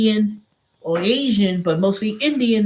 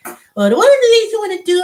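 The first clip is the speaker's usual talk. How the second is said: imitating the trainer; 12 semitones higher, 7 dB louder than usual.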